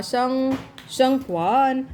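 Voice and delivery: usual speaking voice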